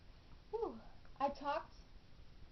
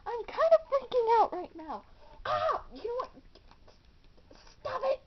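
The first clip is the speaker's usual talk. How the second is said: freaking out; 9 semitones higher, 7 dB louder than usual. Acted. Panicking girl, light in eyes.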